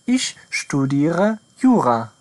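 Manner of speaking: each word laid out slowly; very slow model sentence. Shown as a demonstration. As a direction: read it out slowly for beginners. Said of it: "model sentence for learners".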